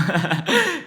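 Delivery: loud, high burst of laughter; real laugh. Real, not acted. Player after acting the kid's reply, real loud laugh. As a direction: laugh out loud at your own act.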